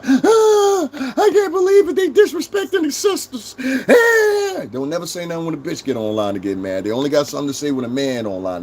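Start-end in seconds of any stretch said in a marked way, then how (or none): 0.1-3.6 shrill mock wail, much higher and louder, whining about sisters; mock outraged woman. Streamer mocking an outraged woman online, high squealing whine. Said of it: "But a bitch will get online". 3.9-4.7 high, loud fake sob; mock wailing cry. Streamer ending his parody with a high fake wail.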